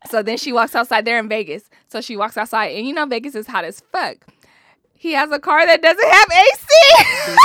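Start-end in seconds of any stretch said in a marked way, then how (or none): 5.0-7.5 loud. Real, not acted. Shouting punchline about no AC.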